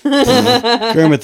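high laughing squeal. Real, not acted. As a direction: laugh through the joke. Laughing repeat of joke.

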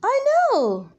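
very high, loud squeaky cry; excited tiny fairy cry. A narrator's reading. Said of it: "cried the Lilia's fairy".

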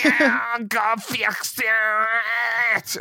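put-on high, hugely drawn-out kid wail; whiny child voice. Kid pestering someone to fix it, whiny child voice. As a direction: stretch it into a long childish whine. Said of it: "whiny child voice".

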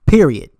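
a loud single-word full stop; emphatic period. Real, not acted. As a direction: punch "Period." loud and final. Emphatic end to the rant.

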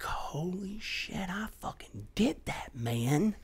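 slow, breathless whisper of disbelief; awed whisper. Retold or imitated. Reaching the end of a hard hike, awed whisper. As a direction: whisper it in amazement.

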